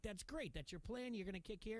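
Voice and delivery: high, amused mock surprise. Adults reacting to nine-year-old's plan.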